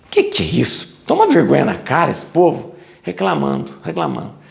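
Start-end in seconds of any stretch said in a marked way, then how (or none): none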